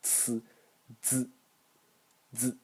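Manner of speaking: the second-row sound as a soft, slightly voiced burst; just the burst, barely voiced. Shown as a demonstration. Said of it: "only the explosion".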